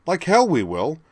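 high, loud defiant shout; tied-up Jerry shouting defiance from behind; defiant cry. Acted. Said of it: "Jerry's voice cried".

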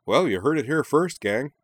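high, loud and pleased; happy announcement. A narrator's reading. Ryo claims the company from his sister, happy crowing. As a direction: crow it to the whole gang. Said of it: "said Ryo happily".

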